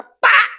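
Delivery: loud, high "Pá!" for the slap; slap sound. Retold or imitated. Preacher imitating the slap she took in the fight. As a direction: smack the word out like the slap landing.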